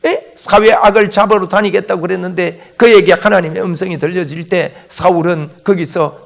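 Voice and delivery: ordinary talk, nothing marked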